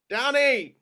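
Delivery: loud, high yell across the field; calling out. Acted. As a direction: call him over from far off. Calls a soldier from afar.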